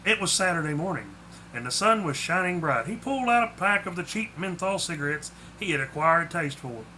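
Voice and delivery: ordinary delivery, nothing marked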